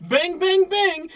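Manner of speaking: mock gunshots. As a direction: imitate a gun going off. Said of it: "That is gun going off".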